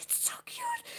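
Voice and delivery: tiny, very high squeal; squeaky mock gushing; gushing kid. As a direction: squeal like a kid overwhelmed by cuteness. Voicing self as squeezing kid.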